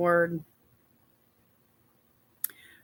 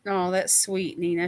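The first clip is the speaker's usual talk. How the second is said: normal talking voice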